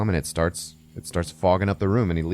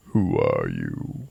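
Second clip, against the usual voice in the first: very deep, slow menacing growl; low, drawn-out threatening question; Stephen Lang; 14 semitones lower, much slower than usual. Retold or imitated. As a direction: imitate a menacing tough old vet. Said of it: "I love his voice".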